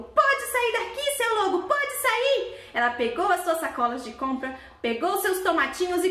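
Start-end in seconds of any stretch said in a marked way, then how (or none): 0.0-2.6 high, slow, firm scolding; the mother goat returning and driving the wolf away. Acted. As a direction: order the wolf out like a stern mom.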